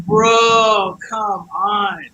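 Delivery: drawn-out, high-pitched pleading shout; exasperated protest. Retold or imitated. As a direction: cry out in disbelief at a bad offer. Protesting the offer of a van.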